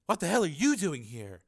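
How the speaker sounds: high-pitched indignant outburst; angry scream. A narrator's reading. Reporter confronting her rival in battle, mild angry scream. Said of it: "screamed Sheila".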